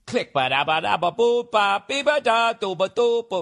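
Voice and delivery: scat riff. Retold or imitated. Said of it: "Remember that fucking song Led Zeppelin did?".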